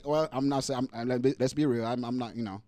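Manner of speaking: normal talking voice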